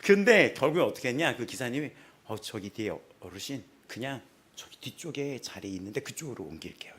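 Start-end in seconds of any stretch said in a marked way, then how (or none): 2.3-6.9 low, much softer, fading to a whisper; hushed. Retold or imitated. Bus driver quietly offering the old man a seat, hushed.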